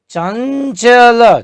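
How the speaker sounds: चंचल said slowly, the ञ nasal drawn out; clear demo word. Shown as a demonstration. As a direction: stretch चंचल syllable by syllable. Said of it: "च वर्ग का जो ञ है". (चंचल धीरे-धीरे, नासिक्य उच्चारण साफ़ करते हुए)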